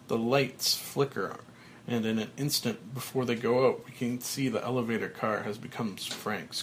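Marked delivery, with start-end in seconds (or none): none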